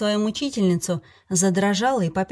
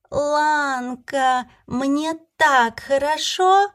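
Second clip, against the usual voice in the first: high, slow, giddy fairy; tipsy fairy voice; 7 semitones higher, much slower than usual. Acted. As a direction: gush like a giddy drunk fairy. Drunk fairy rejoices.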